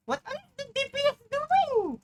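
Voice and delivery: very high, loud frustrated question; exasperated yell. Real, not acted. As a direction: shout at the team. Frustrated gamer yells at team.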